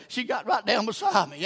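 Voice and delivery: ordinary talk, nothing marked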